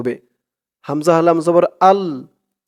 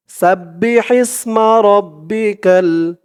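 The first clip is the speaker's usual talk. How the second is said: slow recitation with a drawn-out final vowel; tajweed recitation; 3 semitones higher, much slower than usual. Shown as a demonstration. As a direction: recite the verse for students to copy. Teacher recites verse slowly.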